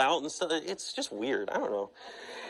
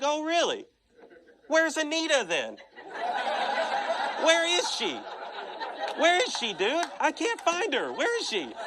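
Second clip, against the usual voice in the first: high, laughing mock search; sing-song teasing where-is-she; mocking taunt; 13 semitones higher than usual. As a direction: taunt him with a giggle in the voice. Taunting him about pastor's ex-wife.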